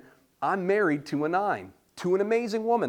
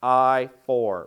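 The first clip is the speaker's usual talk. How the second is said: a mock bingo caller's call, louder; 3 semitones lower, 7 dB louder than usual.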